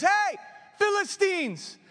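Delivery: high, loud call across the cliffs. Acted. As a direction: hail the enemy from below. Jonathan calls out to enemy.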